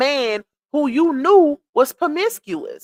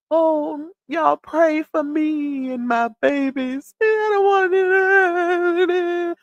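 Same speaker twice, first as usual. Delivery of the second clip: slow, drawn-out fake plea, pitched up at the end; mock pleading; 4 semitones higher, slower than usual. Vlogger mocking a mother begging online for prayers, exaggerated pleading. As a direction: imitate someone milking it for sympathy. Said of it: "you, you pretending like".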